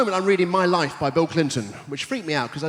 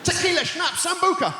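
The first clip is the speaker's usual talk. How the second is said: shouted drink list; 10 semitones higher than usual. Acted. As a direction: bellow the drinks like calling a round.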